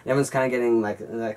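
usual speaking voice

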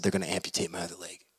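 very soft, low, quick admission; downcast. Acted. Anderson tells his visitor about the amputation, downcast. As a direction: give bad news quietly, head hung low. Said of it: "Anderson has his head low".

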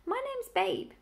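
high, jolly piglet voice. A narrator's reading. Piglet making friends with the old sheep, jolly. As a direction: introduce yourself brightly, pitched well up. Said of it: "he said in a jolly voice".

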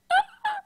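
delighted shriek of laughter; high squealing laugh. Retold or imitated. Reacting to the Sean Connery quote, high squealing laugh.

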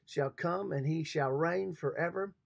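ordinary delivery

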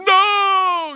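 high, hammed-up wail; sobbing little girl. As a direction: cry it out like a distraught child. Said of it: "the girl was crying".

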